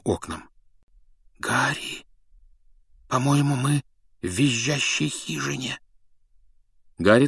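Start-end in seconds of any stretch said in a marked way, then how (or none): 1.4-5.8 hushed and scared. Acted. Scared girl in haunted house.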